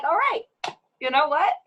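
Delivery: high, bright-eyed all right, you know what; mock breezy shrug. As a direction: brush it off with a high sing-song shrug. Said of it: "And you're like".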